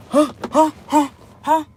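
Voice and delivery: lightly voiced dazed breathy huhs; imitating dazed daughter. Mother imitating her groggy daughter, mild dazed huhs. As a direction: mimic a confused girl just woken. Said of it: "She do this".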